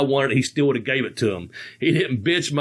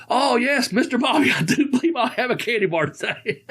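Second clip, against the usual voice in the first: much higher, sing-song mock-polite driver voice, laughing through it; polite limo driver; 9 semitones higher than usual. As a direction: pitch it way up like an overly polite chauffeur accepting a gift. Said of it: "he goes".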